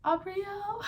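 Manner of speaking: high, loud joyful rising call; overjoyed. Retold or imitated. Mother first seeing her baby, joyful cry. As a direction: call her name in wonder. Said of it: "and I was like".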